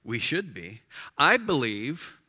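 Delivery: usual speaking voice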